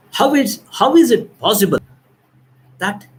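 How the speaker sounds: high, loud panicked cry of disbelief; frantic, rising shriek; panicked scream. Acted. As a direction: wail it out as if your friends all vanished. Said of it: "she was screaming".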